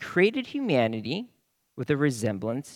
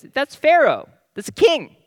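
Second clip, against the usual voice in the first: higher, louder imagined exclamation; confident realization; 11 semitones higher, 8 dB louder than usual. Acted. Preacher voices the Israelites' thinking on image of God, confident realization. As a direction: blurt the answer, pitched high and loud. Acting out Israelites' sure answer.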